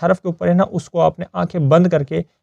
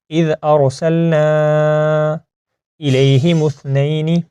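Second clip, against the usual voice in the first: slow, drawn-out Quranic recitation; slower than usual. Shown as a demonstration. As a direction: recite slowly with long held vowels. (ٹھہر ٹھہر کر تلاوت)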